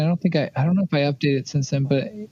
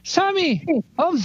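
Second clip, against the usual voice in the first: much higher, pleading character voice; 13 semitones higher than usual. Acted. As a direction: coax Sammy gently to back down.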